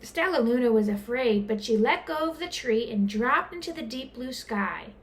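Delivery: plain reading voice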